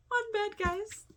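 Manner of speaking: high, squealing delight; thrilled squeal. Real, not acted. Telling viewers about the one-bed scene, thrilled squeal. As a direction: squeal it like the best news ever.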